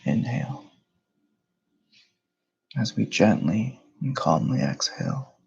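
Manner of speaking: measured meditation guide, long pauses between phrases; guided meditation. Real, not acted.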